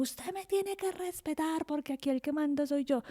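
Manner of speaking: high-pitched mock yell of a strict dad; mock yelling father. Daughter imitates her father shouting demands for respect, mock yell. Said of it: "gritándome".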